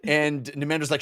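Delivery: usual speaking voice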